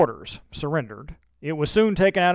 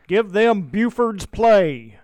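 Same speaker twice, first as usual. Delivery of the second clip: loud, slow battlefield call; 6 semitones higher, 6 dB louder, slower than usual. A narrator's reading. A militiaman calls out to keep shooting, loud and slow. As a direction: bellow it across the hilltop. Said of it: "someone calling".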